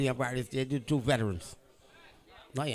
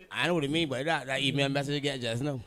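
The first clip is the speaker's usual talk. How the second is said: usual speaking voice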